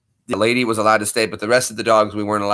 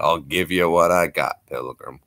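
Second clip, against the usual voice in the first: John Wayne; 6 semitones lower than usual. Retold or imitated. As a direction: do the Duke. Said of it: "I'm doing my best as the Duke".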